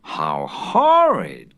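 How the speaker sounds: slow, drawn-out shocked exclamation; horrified cry. A narrator's reading. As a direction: recoil at the sight, stretch it out. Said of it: "cried Missus Otis".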